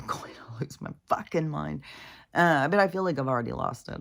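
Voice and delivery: ordinary delivery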